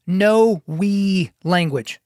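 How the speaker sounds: very slow, spaced-out "no we language"; word-by-word emphasis. Shown as a demonstration. Podcaster announcing his new sports rule, word by word. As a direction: leave a gap after each word.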